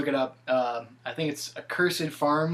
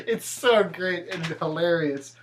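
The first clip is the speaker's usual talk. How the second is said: ordinary talk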